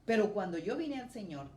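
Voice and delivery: ordinary talk, nothing marked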